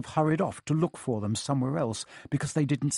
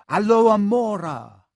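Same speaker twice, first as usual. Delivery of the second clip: ordinary delivery, nothing marked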